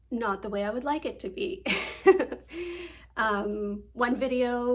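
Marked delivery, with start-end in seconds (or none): 1.7-2.9 higher, louder burst of laughter; self-deprecating laugh. Real, not acted. YouTuber admitting her videos are not how she'd like, laughing. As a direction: laugh it off at your own imperfection.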